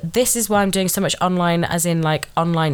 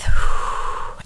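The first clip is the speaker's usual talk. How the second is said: breathy sigh; 3 dB louder than usual. Real, not acted. Calming herself down.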